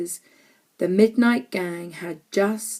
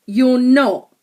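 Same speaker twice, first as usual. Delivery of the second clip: short, firm refusal, a little louder; curt; 3 semitones higher, 6 dB louder than usual. A narrator's reading. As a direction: cut her off curtly. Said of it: "said George curtly".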